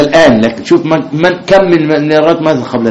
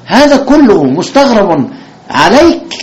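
mock astonishment; 8 semitones higher, slower than usual.

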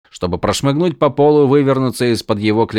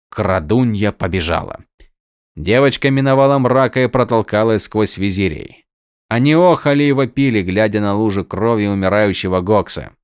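ordinary delivery, nothing marked

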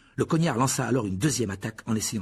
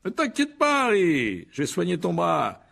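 high, showy reassurance; pompous Lockhart, loudly reassuring; 7 semitones higher, 4 dB louder, slower than usual. A narrator's reading. Lockhart offering to heal Harry's arm, high showy voice. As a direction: proclaim it high and loud.

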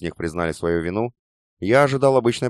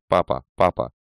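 distant echo; 4 semitones lower than usual. A narrator's reading. Daughter's voice echoing in memory.

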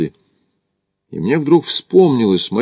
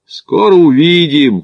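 loud, higher, drawn-out call; shouted; 6 semitones higher, 5 dB louder, slower than usual. A narrator's reading. Smith catches up with his companion, shouting to him ahead. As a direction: call it out ahead, stretching the words. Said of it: "крикнул Смит".

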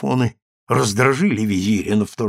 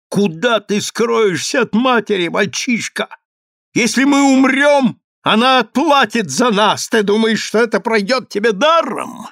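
raised, high-pitched angry threatening; ferocious threat; 9 semitones higher than usual. A narrator's reading. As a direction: threaten the child in mounting fury. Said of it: "Визирь бросил свирепый взгляд".

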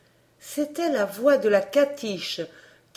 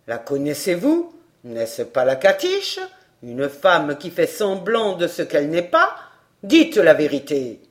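stern gendarme; 4 semitones lower than usual. A narrator's reading. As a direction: demand it with authority. The gendarme questions.

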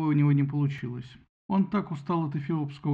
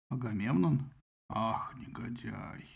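низко, очень медленно и гулко; пророкочи возмущение; возмущённо; 4 semitones lower, 5 dB quieter, slower than usual. A narrator's reading. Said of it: "возмутился Зевс". (низкий медленный гнев)

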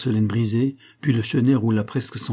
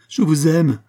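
loud, higher, insistent exclamation; vehement cry; 6 semitones higher, 7 dB louder, slower than usual. A narrator's reading. Servant stamps her foot insisting on her love, vehement cry. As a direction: drive it home forcefully. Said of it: "répéta la jeune fille en frappant du pied avec violence".

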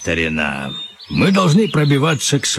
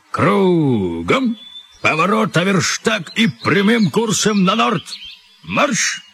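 commanding order; 8 semitones higher than usual. Acted. Admiral commands son.

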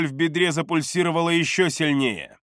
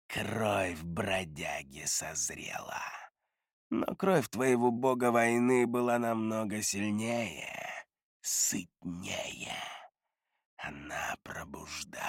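low, slow, much softer whisper; a hungry spirit savouring blood; creepy whisper; 6 semitones lower, 10 dB quieter, slower than usual. Acted. The dismemberer speaks of blood.

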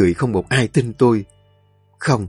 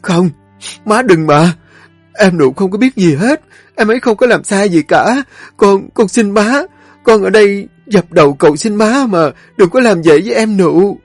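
high, loud desperate begging; daughter-in-law sobbing and begging her mother-in-law; sobbing plea; 9 semitones higher, 5 dB louder than usual. A narrator's reading. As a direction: throw herself down and beg. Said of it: "vừa khóc nấc vừa dập đầu".

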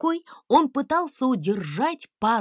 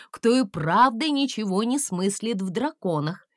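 ordinary delivery

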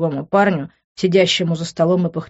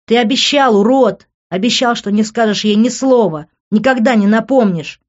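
loud, raised-pitch accusation; heated, forceful outburst; angry yelling; 5 semitones higher, 6 dB louder than usual. A narrator's reading. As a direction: yell it as a furious reproach. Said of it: "заорал он".

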